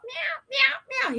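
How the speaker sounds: high, mock kitten "Meow, meow, meow". Imitating the kitten crying from the bushes in high meows. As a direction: squeak like a scared kitten. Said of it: "Boots screaming his little head off".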